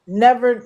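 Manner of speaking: Never punched loud; emphatic Never; stress on never. Real, not acted. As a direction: hit Never hard. Emphatic denial.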